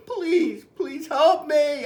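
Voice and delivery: high-pitched, drawn-out begging; storybook boy begging for help; desperate plea. Acted. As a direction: plead slowly and desperately, voice high. Boy begs Mister Carp by phone.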